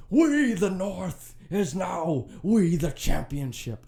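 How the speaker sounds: high, loud, slow play-by-play title call, hammed up; Mike Breen impersonation. Podcaster recreating the title call for listeners, hammed-up loud slow announcer call. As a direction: belt it out like a TV announcer calling the championship. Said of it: "terrible Mike Breen impersonation".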